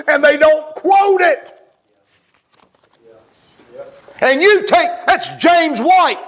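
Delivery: high, emphatic pulpit declamation; full-force preaching. Real, not acted. Preacher challenging the congregation on whom they take serious, full-force preaching. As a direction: drive each name home from the pulpit.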